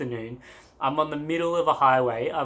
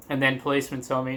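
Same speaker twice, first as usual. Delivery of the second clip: normal talking voice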